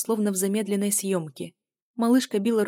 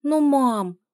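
high, loud whine at mom; drawn-out childish protest; pouting child; 7 semitones higher, 7 dB louder than usual. A narrator's reading. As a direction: whine with a pout. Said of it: "она надула губки".

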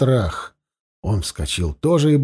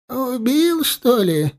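high trembling question; quavering voice; 9 semitones higher, slower than usual. A narrator's reading. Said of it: "спросил дребезжащий голос". (дребезжащий высокий голос)

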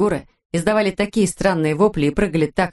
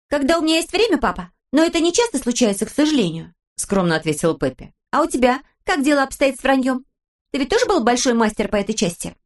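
put-on high, bright little girl; lively young daughter; cheeky girl voice; 7 semitones higher than usual. A narrator's reading. Pippi voiced in story. (голос девочки)

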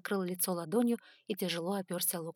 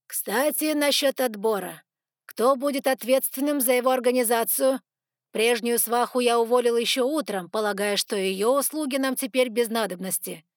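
louder and higher, addressing the room; raised voice; 4 semitones higher, 9 dB louder than usual. A narrator's reading. Jefferson turning to the question of the selection, raised voice. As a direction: raise your voice to announce new business. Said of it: "и поднял голос".

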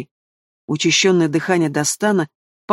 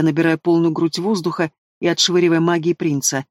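ordinary delivery

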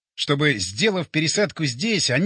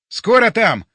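loud, high impatient call; shouting; 5 semitones higher, 8 dB louder, slower than usual. A narrator's reading. Narrator to batya waiting on the machine, shouting. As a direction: call it out urgently. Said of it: "крикнул я бате".

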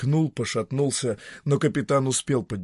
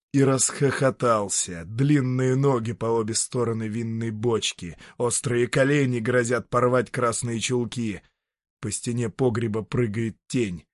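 plain reading voice

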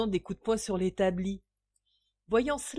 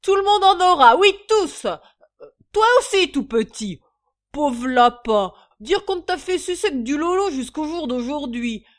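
much higher, loud boisterous rant; celebrate, tipsy and generous; drunken exuberance; 6 semitones higher, 10 dB louder than usual. A narrator's reading. Drunk father offering wine to all his children, exuberant.

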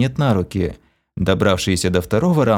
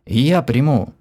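husky, higher and strained; hoarse; 5 semitones higher, slower than usual. Acted. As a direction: say it hoarsely through tension. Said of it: "хрипло произнесла Кира".

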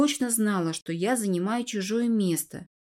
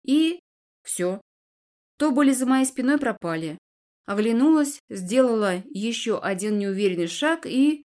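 ordinary delivery, nothing marked

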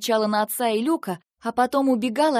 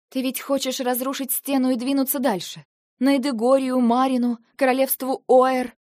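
rising accusation; 5 semitones higher, 5 dB louder than usual. Acted. Eva confronting Lentar about war.

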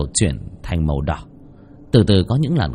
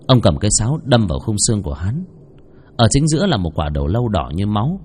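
usual speaking voice

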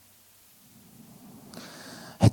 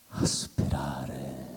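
fading echo; 4 semitones lower, 6 dB quieter, much slower than usual. Acted. Second take, fading.

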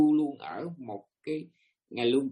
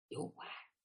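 "dốt quá" whispered, breathy, no voice; whispered echo; 19 dB quieter than usual. Shown as a demonstration. Teacher to his listeners, repeating "dốt quá" in a whisper. Echoed phrase whispered after.

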